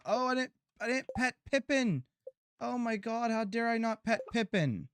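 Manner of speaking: high-pitched, dramatic self-reproach; mock horror. Real, not acted. Player forgot to pet Pippin, high dramatic dismay.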